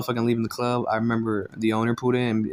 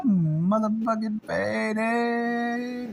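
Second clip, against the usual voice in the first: drawn-out stoned cheer; 14 semitones higher, 3 dB louder, much slower than usual. Retold or imitated. Celebrating while high.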